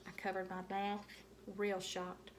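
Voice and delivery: usual speaking voice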